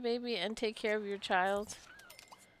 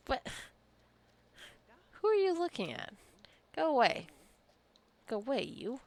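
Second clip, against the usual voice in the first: mock shooing; 6 semitones higher than usual. Shooing a Sim away.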